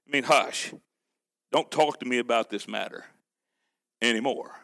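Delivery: low, soft firm hush; hushed stern Lord. Acted. The Lord telling Moses to stop asking, low and hushed. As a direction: quietly shut him down.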